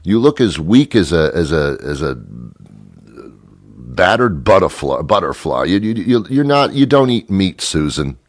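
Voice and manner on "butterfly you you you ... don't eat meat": ordinary talk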